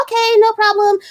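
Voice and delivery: high, polite made-up voice; put-on friend voice. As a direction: lift the pitch and sound agreeable. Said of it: "I'm gonna give her this voice".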